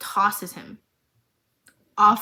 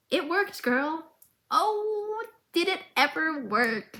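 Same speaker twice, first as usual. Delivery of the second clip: put-on much higher, gleeful child voice; proud little girl; 7 semitones higher, slower than usual. Powder celebrating her bomb, high and gleeful. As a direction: squeal it like a proud kid.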